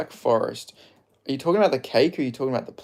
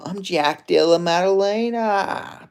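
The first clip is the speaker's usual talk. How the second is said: higher, slow, altered voice; slow, pitched-up laid-back Aussie fighter voice; Jack Della Maddalena impression; 8 semitones higher, much slower than usual. Shown as a demonstration. Streamer shows chat his slow pitched-up impression of fighter Jack Della Maddalena. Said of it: "I could sort of do a J D M".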